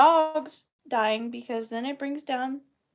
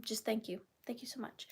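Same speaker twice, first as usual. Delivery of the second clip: a soft breathy whisper, no voice at all; whispered thanks; 13 dB quieter, faster than usual. Real, not acted. Whispering her heartfelt thanks to a generous viewer.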